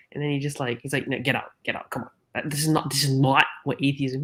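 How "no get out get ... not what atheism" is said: ordinary talk, nothing marked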